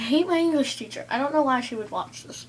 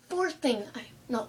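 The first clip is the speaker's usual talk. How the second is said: ordinary delivery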